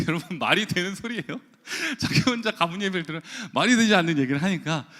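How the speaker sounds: real laugh breaking into the words; laughing disbelief. Real, not acted. Preacher telling congregation about his wife's proposal, laughing in disbelief.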